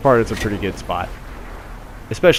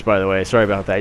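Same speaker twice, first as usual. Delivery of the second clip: ordinary talk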